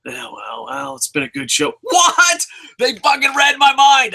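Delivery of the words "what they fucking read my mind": high, loud shout of amazement; thrilled fan can't believe it; surprised shout. Real, not acted. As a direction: yell in joyful disbelief. Surprised the wish came true.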